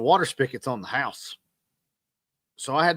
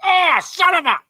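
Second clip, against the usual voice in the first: high yelped curse after smacking the head; pained yelp; 8 dB louder than usual. Retold or imitated. Husband hitting his head on the car door, high yelp. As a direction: yelp it out, cut off mid-curse. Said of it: "and I'm like".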